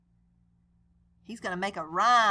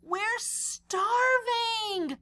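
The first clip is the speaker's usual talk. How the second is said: mild starving cry; 10 semitones higher, much slower than usual. Acted. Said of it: "all capitals ... they're yelling it".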